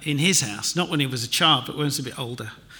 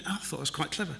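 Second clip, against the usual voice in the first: ordinary delivery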